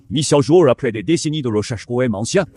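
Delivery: usual speaking voice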